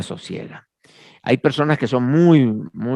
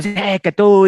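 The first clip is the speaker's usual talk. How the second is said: louder, higher mock yell; imitated shouting; 7 semitones higher, 5 dB louder than usual. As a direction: mimic an angry man yelling at you. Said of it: "Me gritó y me dijo". (imitando a un hombre que grita)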